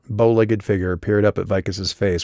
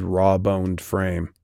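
usual speaking voice

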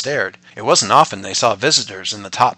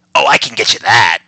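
squirrel voice; 9 dB louder than usual. Acted. Squirrel character speaking.

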